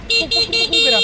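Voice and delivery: squeaky high "puk puk" horn imitation; high-pitched horn noise. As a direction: honk like a little car horn. (উঁচু গলায় হর্নের আওয়াজ নকল)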